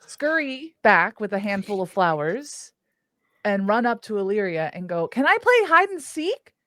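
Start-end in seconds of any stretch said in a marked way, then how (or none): 5.1-6.5 high, loud, excited request; eager asking. Acted. Elf runs up to Illyria, high and eager. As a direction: ask like an eager kid. Elf begs friend to let her play.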